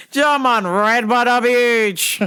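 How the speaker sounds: high, loud Jamaican patois voice; mock Jamaican accent. Dreadlocked guard challenging him in a Jamaican accent.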